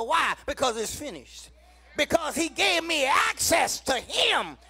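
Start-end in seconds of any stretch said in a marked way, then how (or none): 2.0-4.6 full-force preaching. Acted. Preacher calling the church to rejoice, loud sung-out preaching. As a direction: lift the church with every phrase.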